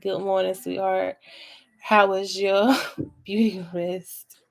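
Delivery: slow, sugary "Good morning, sweetheart" with a laugh; mock-tender, drawn out and slow; sweet morning greeting. Imitating her sweet texts to him, slow and syrupy. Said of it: "and I be like".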